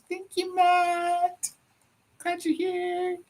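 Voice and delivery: very high, drawn-out sing-song thanks; sing-song greeting. Real, not acted. Host thanking a viewer for joining, sing-song greeting.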